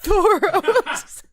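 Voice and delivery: name blurted out high and loud through laughter; trying to read but cracking up; laughing. Real, not acted. Reading the next name aloud to co-host, cracking up. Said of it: "I'm trying, you guys".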